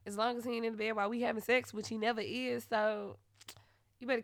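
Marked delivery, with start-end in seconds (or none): 0.0-1.7 fast quoted rule. Retold or imitated. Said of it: "they used to be like".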